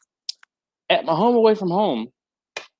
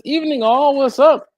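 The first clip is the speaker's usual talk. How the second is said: higher and louder greeting; cheery read-out; 9 semitones higher, 6 dB louder than usual. Real, not acted. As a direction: belt out the viewer's hello. Reading chat greeting out loud.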